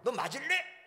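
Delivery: loud, high warning to a pupil; threatening shout. Retold or imitated. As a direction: threaten them with a loud bark.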